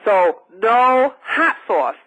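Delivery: normal talking voice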